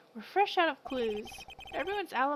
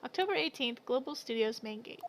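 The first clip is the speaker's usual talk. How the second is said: usual speaking voice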